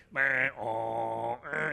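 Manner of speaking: loud buzzing machine drone; droning mechanical noise; imitated MRI noise. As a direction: mimic the MRI's loud groaning hum. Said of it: "but it's like".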